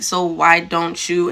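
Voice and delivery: flat and skeptical, in no hurry; slow deadpan question. Retold or imitated. Telling a coworker so why don't you, slow and deadpan. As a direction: drag out a flat, unimpressed question. Her own retold reply.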